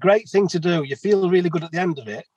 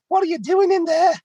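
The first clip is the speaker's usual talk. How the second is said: very high put-on voice of the curious neighbour; crappy accent; 13 semitones higher than usual. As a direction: pipe up high like the stranger. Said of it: "Sorry about my crappy accent".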